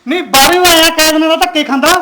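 husband shouting back at his wife in the quarrel; angry shout. Acted. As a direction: yell it back in the fight.

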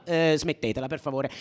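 a tired, soft plea; weary plea. Acted. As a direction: sigh it out, tired of hearing it. Fed-up plea to stop.

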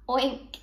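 short pig's oink. A narrator's reading. Farm animals heard from next door, pig oink. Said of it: "happy sounds of the animals".

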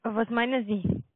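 ordinary talk

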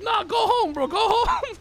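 high, whiny comic begging; insistent pleading. Retold or imitated. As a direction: plead high, acting out exasperation. Retells pleading with neighbor.

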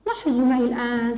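normal talking voice

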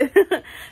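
loud high laugh; burst of laughter. Real, not acted. Reviewer laughing at her own impression, a loud burst.

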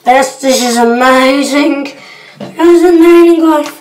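building to a loud, thrilled shout of amazing; excited. Real, not acted. Vlogger to viewers, blown away by a stunt, shouting with excitement.

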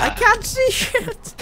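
high, drawn-out exasperated yell; frustrated yell. Real, not acted. Complaining about lag in game.

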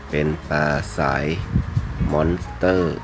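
ordinary talk

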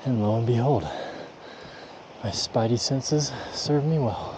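excited. Real, not acted. Elk found after the climb.

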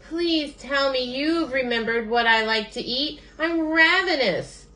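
high, desperate storybook plea; pleading Bird. A narrator's reading. Hungry Bird begs Raccoon, high pleading voice. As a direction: beg in a high voice, drawing out the last words.

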